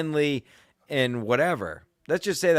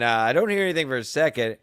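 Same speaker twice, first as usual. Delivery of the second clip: ordinary delivery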